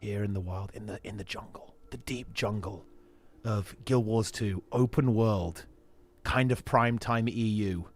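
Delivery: hushed nature documentary narrator; wildlife watching whisper. Pretend. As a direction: whisper like you might scare the animals. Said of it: "We were full safari mode there".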